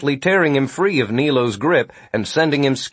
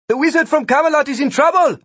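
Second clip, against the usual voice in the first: high, quick call over a crowd; shouted; 4 dB louder, faster than usual. A narrator's reading. Vikram calling to his friends in the crowd, shouted. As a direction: shout the alarm across in a raised voice. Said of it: "Vikram shouted to them".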